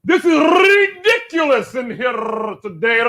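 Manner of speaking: crying with rage. Retold or imitated. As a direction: wail it like an arrested man in a rage. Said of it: "he was rolling R's".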